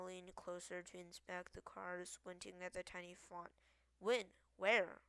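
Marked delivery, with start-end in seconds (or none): 4.0-5.0 loud, sudden questions; taken aback, voice raised; urgent questioning. A narrator's reading. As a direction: fire out two startled questions. Startled questions about the card.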